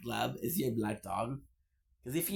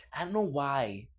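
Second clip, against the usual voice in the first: normal talking voice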